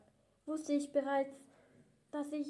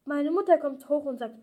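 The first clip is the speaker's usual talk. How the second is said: ordinary delivery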